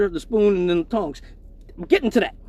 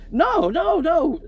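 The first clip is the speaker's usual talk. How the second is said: squealed denial; 8 semitones higher, much slower than usual. Retold or imitated.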